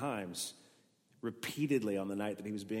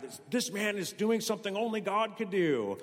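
mock realisation; 10 semitones higher, 6 dB louder than usual. Religious leaders' realisation voiced.

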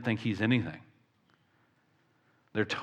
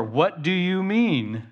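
slow, higher, puzzled exclaim; mock exclamation; 6 semitones higher, slower than usual. Preacher voicing Nicodemus's baffled exclamation, slow and raised. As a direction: act out a baffled outburst. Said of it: "exclaimed Nicodemus".

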